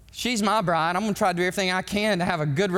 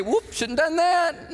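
mock God oops; 10 semitones higher than usual. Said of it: "God's gonna say".

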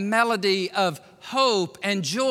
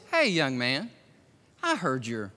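voice of truth; 6 semitones lower than usual. Shown as a demonstration. Preacher demonstrating the voice of truth, low and gentle. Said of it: "voice of truth that sounds something like".

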